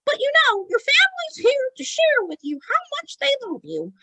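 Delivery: very high ventriloquist falsetto; high-pitched put-on spirit voice; childlike spirit sign-off. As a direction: deliver a message of love in a squeaky puppet voice. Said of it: "And then he's like".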